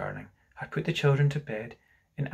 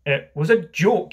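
loud, raised-pitch protest; roared; 4 semitones higher, 7 dB louder than usual. Acted. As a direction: roar it in self-defence. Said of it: "he roared".

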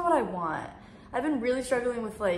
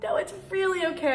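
high polite refusal; 10 semitones higher than usual. Retold or imitated. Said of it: "I was like".

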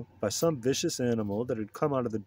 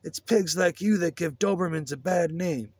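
high-pitched acted holler; indignant character shout; angry yell; 8 semitones higher than usual. A narrator's reading. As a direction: hurl the insult in a raised, put-on voice. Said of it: "Lester screamed".

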